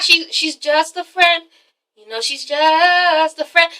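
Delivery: slow, stretched-out defensive line; drawn-out excuse. Acted. Derek explaining away a friend to his girlfriend, drawn-out excuse. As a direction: draw out "just" while making excuses. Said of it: "And Derek's like".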